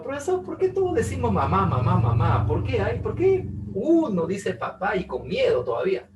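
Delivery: high-pitched mock student; student asking. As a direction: ask the teacher like an eager pupil. Student's question voiced.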